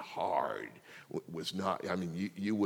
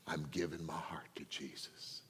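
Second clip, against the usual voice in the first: soft tearful whisper; 5 semitones lower, 12 dB quieter than usual. Retold or imitated. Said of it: "He was crying".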